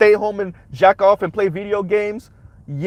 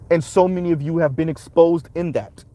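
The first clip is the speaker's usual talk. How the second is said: ordinary talk, nothing marked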